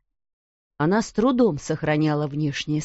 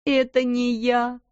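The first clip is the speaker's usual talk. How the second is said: tearful; 5 semitones higher than usual. A narrator's reading. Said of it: "с плачем уверяла Бекки".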